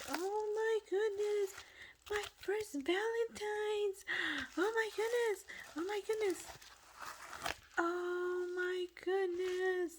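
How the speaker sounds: high, slow, gushing oh my goodness; excited squeal. Real, not acted. Crafter opening a friend's gift, gushing with real excitement. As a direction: melt over the adorable gift.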